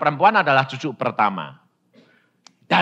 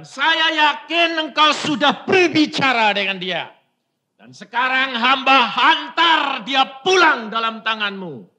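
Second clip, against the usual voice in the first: much higher and louder, crying out to God; fervent prayer shout; 10 semitones higher, 5 dB louder than usual. Retold or imitated. Father prays aloud over dying daughter, fervent shout. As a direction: shout the prayer with full faith.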